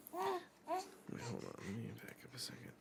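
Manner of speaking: fast whispered aside. Real, not acted. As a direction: hush it quickly to yourself. Aside interrupting the reading.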